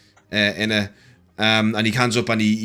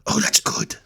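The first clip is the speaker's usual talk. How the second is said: whispered Voro; 11 semitones higher than usual. Acted.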